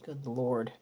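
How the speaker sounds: low, soft exhalation of dismay; stunned murmur. Real, not acted. Reader stunned by what Spirit gives, stunned murmur. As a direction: drop right down, almost to yourself.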